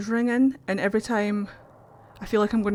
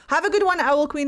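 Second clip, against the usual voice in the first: cheerful; 7 semitones higher, 3 dB louder than usual. Real, not acted. Cheerful goodbye to a viewer.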